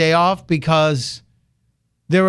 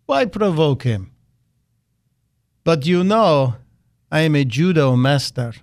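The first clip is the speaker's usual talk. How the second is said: bad Putin voice. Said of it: "after saying".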